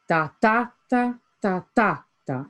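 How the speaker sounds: "ta ta ta, ta ta ta" chanted in even beats; rhythm beats. Shown as a demonstration. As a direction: beat out the metre on "ta". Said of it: "Now look at the stressed syllables".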